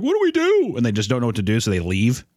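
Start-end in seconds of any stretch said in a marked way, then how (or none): 0.0-0.7 put-on kid voice. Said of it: "And the kids are like".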